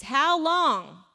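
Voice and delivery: loud, drawn-out challenge to a crowd; addressing a crowd. Acted. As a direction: call it out big, as if facing all the people. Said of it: "standing in front of all these people".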